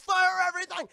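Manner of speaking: high, loud battle command; over-the-top battle cry; shouted order. Retold or imitated. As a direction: bellow the order, hammed up. Acted order to fire all cannons.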